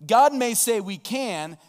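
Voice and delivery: God may say, slow and loud, GOD stressed; slow emphatic declaration. Acted. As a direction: hammer home what God says. Preacher contrasts God's word.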